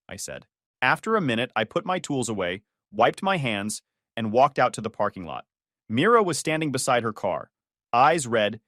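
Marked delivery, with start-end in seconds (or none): none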